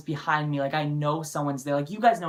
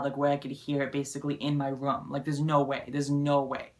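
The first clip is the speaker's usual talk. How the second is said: ordinary talk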